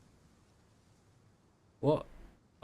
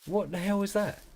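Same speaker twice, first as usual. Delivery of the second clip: high alarmed outburst; shocked, pitch jumping up; what was that noise, high and rattled; startled; 11 semitones higher than usual. Real, not acted. Repeats after strange noise.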